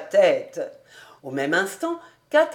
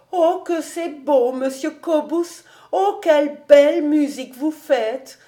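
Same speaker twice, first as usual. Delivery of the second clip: higher, raised, delighted voice; admiring girl; 6 semitones higher, 4 dB louder than usual. A narrator's reading. Suzel praising Monsieur Cobus, higher and louder. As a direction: gush with wonder.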